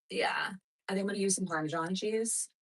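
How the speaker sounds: whispered to herself; whispering. Real, not acted. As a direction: breathe it out as a private aside. Repeats choice under breath.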